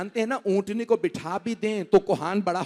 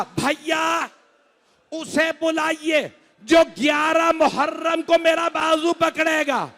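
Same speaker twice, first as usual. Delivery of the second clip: high, loud weeping cry to her brother; 9 semitones higher, 5 dB louder, slower than usual. Acted. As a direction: wail the plea through tears. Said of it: "रो के कहा".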